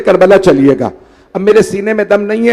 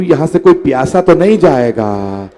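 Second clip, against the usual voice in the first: ordinary talk, nothing marked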